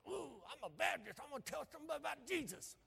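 mock-menacing caricature of a mean Baptist; mean-faced Baptist. Pastor parodying Baptists out witnessing, mock mean voice. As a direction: put on a mean face and growl it in parody. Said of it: "with the mean face".

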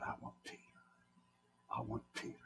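a hushed, voiceless Satan whisper. Acted. Satan whispering his demand to God for Peter. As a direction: hiss the demand like a tempter in the dark.

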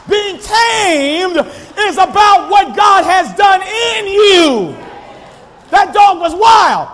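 high, loud pulpit shout; shouting preacher, pressing hard; preaching at full force. Acted. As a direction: call the church out with fire.

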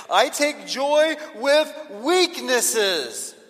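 high, loud, drawn-out proclamation; excited joy. Acted. Apostle taking joy in his weaknesses, excited. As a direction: relish it slowly and loudly. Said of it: "I get real excited about this".

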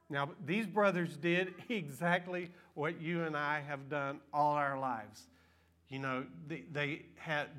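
ordinary talk, nothing marked

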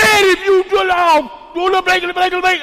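very high-pitched mock yelling; mock cussing out. Woman back to cussing right after praying, mock shrieking rant. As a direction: squawk out a censored tirade. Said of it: "cussing this lady out".

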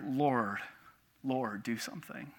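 low, soft prayer under pressure; hushed plea. Retold or imitated. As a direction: quietly beg for help. Retold silent plea to God.

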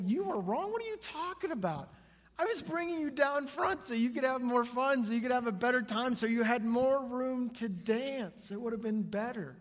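high, loud, incredulous retort; indignant parent. Retold or imitated. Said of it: "so he go, I go".

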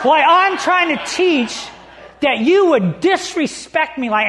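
hammed-up yell at his wife; angry husband. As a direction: retell the outburst, half laughing. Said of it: "with anger in his voice".

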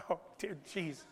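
soft, low, imploring murmur; hushed desperate prayer. Retold or imitated. Congregation praying as Sheila tries, hushed mock prayer. Everyone praying under their breath.

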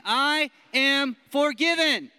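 slow, loud and high affirmation; loud declaration. Shown as a demonstration. Preacher leads the room in declaring, slow and loud. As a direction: shout it like you believe it. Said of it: "Let's say it again".

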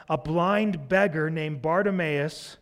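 usual speaking voice

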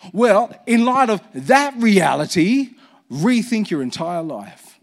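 "rethink your entire life" slowed, each word pressed; slow emphatic preaching. Acted. Pastor urging the church to rethink everything, slow emphasis. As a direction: drive home "rethink". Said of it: "preaching at full force".

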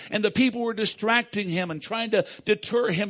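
ordinary talk, nothing marked

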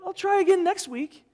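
mock meek reply. Imagined church member accepting insult, high meek mock voice. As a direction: play a pushover who takes the insult. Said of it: "you wouldn't be like".

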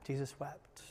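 breathy near-whisper, very soft; Jesus wept breathed out as a whisper; hushed whisper. Shown as a demonstration. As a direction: let it barely leave your lips.

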